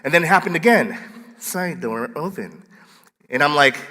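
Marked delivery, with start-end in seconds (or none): 1.4-2.6 slow, soft automated announcement; be the alarm system's voice, slowly and softly; Telus alarm lady. Retold or imitated. Pastor imitating the office alarm lady, slow robotic announcement. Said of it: "the Telus lady says".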